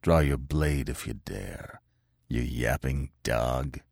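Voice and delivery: a dangerous calm before a fight; soft, slow threat to the hillman; softly. A narrator's reading. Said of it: "he said softly".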